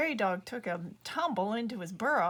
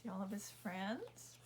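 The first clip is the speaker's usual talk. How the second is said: hushed aside; 13 dB quieter than usual. Acted. Narrator showing listener the buffalo herd, soft hush. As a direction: murmur it softly like pointing out a secret.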